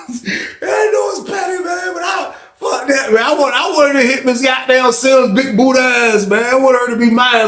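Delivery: high laughing talk, slowed. Real, not acted. Admitting to viewers he's petty, high laughing shout. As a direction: laugh through it, half-shouting. Laughing at his own pettiness.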